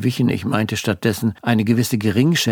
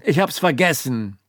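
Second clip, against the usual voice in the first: stern; 6 semitones higher than usual. A narrator's reading. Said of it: "Ich versuchte möglichst streng zu gucken".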